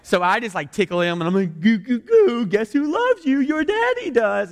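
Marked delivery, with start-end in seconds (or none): 1.6-4.5 put-on high, singsong daddy baby talk. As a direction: coo teasingly as if tickling a little child. Dad tickling and teasing his son.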